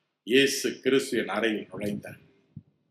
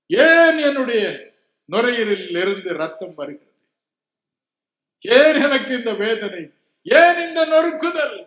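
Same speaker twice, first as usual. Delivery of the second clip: high, loud pleading why; anguished cry to God; 11 semitones higher, 7 dB louder than usual. Retold or imitated. Sick preacher crying out to God, high and loud. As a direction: cry out to heaven in pain.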